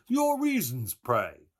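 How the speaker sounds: high, loud, puzzled demand; mock puzzled. A narrator's reading. Mulligan asking Stephen for his reasons, mock puzzled. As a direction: inquire with exaggerated learned curiosity. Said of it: "said in the same tone".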